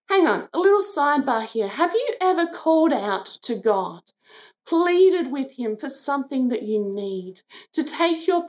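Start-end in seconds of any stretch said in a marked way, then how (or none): none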